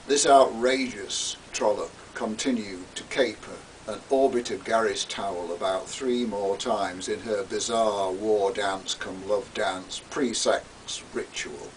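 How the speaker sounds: ordinary delivery, nothing marked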